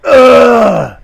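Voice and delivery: loud high climax cry; over-the-top blocky yelp. Acted. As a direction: let out a big high yelp. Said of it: "All three blocks cried out".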